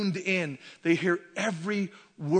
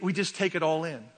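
ordinary talk

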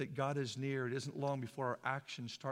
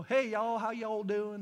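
hearty, higher-pitched Southern greeting; Southern accent; 5 semitones higher, 5 dB louder than usual. Retold or imitated. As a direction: call out a friendly Southern hello. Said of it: "they talk different down there".

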